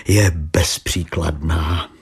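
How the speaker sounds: slow, dry and pointed; blunt. A narrator's reading. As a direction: land the last word bluntly. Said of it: "prohlásil Exner neomaleně". (neomaleně, suše a pomalu)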